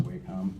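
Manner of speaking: "Wacom" with a long a in the first syllable; pronounced WAY-com. Shown as a demonstration. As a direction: say "Wacom" as way-kum. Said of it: "I think it's pronounced".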